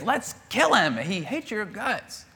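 high, eager soldier voice; keen zealous whisper; eager urging. Acted.

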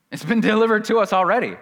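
high, loud, quick burst; emphatic insistence. Real, not acted. Preacher insisting to congregation it's already delivered, loud and emphatic. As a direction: press it home loudly in one rush.

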